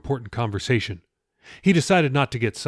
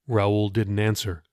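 usual speaking voice

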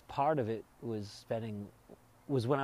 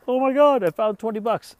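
high, loud, thrilled exclamation; Jerry finding cash in his pocket; imitating delighted Jerry; 11 semitones higher, 12 dB louder than usual. Jerry delighted in retold scene.

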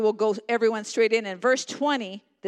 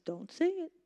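hushed warning; 6 semitones higher, 9 dB quieter than usual. Acted. God's voice warning softly.

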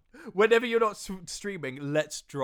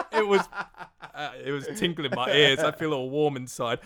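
laughing; 7 dB louder than usual. Real, not acted. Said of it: "laughing after the sexy voice".

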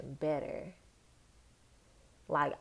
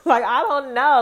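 loud laugh, pitch up; cracking up at the absurdity; 7 semitones higher, 13 dB louder than usual. Real, not acted. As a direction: laugh it off in disbelief. Laughing at her own question.

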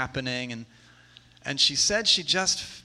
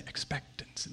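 whispered, as if from on high; hushed, voiceless imitation of God speaking; mock voice of God; 12 dB quieter than usual. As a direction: whisper it like an audible word from God. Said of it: "does God just say".

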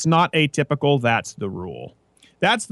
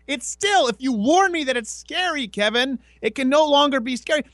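high put-on voice explaining patiently; mock exasperated lecture; 11 semitones higher than usual. Explaining spoilers to Kevin, high mock exasperation. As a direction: plead with a friend who won't get it.

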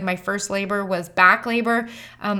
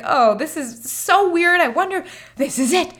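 excited; 7 semitones higher than usual. Retold or imitated. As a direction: savour the thrill, then laugh. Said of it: "And I was so excited".

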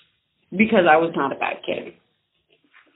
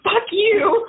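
retold snap; 12 semitones higher than usual. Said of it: "I was like".

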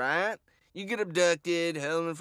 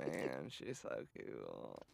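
much lower and softer, dragged out slowly; low, slow drawn-out gush; 15 dB quieter, much slower than usual. Acted. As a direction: drop deep and quiet, drawl it. Gushing about Jeanette, second take.

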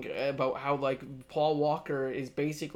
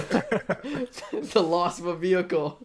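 laughing; 2 semitones higher, slower than usual. Real, not acted. Mock mourning the car.

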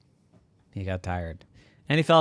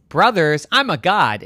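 loud, raised indignant retort; scoffing god; 7 semitones higher, 5 dB louder than usual. Acted. Zeus rebuffing the llama, scoffing loudly.

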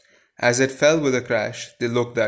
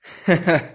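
short laugh; 6 semitones higher, 5 dB louder than usual. Real, not acted. Reader laughs at the story.

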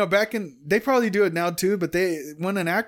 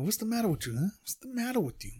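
De Niro impression; 4 semitones higher, 9 dB quieter than usual. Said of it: "he probably does be asking people".

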